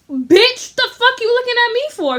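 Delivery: indignant outburst. Retold or imitated. Inner outburst at staring date.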